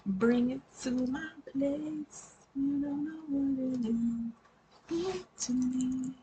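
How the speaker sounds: high, soft, slow and breathy voice; soft high mock-sultry voice; odd put-on voice. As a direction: croon it in a strange seductive voice. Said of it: "I don't know what that accent was".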